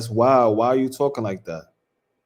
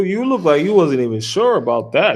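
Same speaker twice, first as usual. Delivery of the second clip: usual speaking voice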